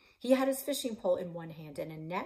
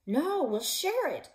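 firm refusal; 9 semitones higher, 8 dB louder, slower than usual. Acted. Refusing partner's oxygen tank.

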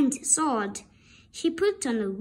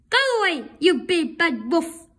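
higher, louder storybook shout; mock shout; 3 semitones higher, 5 dB louder than usual. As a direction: shout the wolf away. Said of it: "they shouted".

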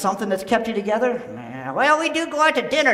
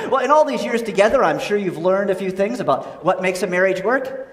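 usual speaking voice

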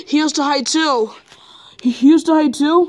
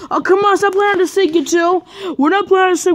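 high, raised pretend-argument voice; playful squabbling; 6 semitones higher, 4 dB louder than usual. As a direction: bicker in play, pitched up high. Pretend squabble over hide and seek.

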